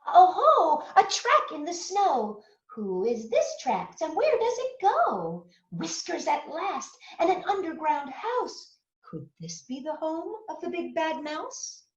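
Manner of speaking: high, eager little monster voice; curious little Gruffalo. Acted. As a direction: play the young Gruffalo searching keenly. Gruffalo's child voice in story.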